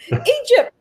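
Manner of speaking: giggling out the word through laughter; high, giddy laugh around Egypt; laughing excitedly. Real, not acted. Excited about dream trip.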